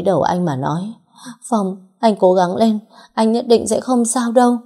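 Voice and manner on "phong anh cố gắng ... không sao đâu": urgent pleading. A narrator's reading. Holding stabbed husband's head.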